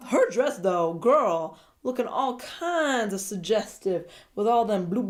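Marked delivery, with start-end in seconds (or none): none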